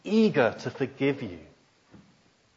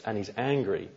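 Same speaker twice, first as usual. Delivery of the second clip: ordinary delivery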